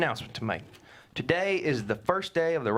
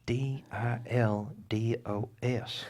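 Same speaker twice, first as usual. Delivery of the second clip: spelled out slowly; 3 semitones lower, 4 dB quieter, much slower than usual. Acted. Spelling word in front of kids.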